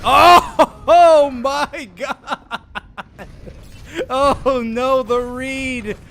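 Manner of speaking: loud laughing shout. Real, not acted. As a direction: burst out laughing in disbelief. Caster reacts to big play.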